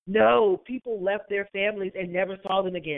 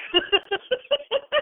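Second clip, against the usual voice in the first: real laugh, pitched way up; high laugh. Real, not acted. S0 laughing at her own mock sobbing, high.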